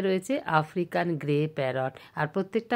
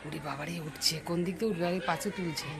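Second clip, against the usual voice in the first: hushed talk; 8 dB quieter than usual. Real, not acted. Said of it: "আমরা কেন যে আস্তে কথা বলছি".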